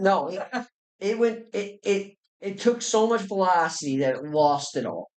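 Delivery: normal talking voice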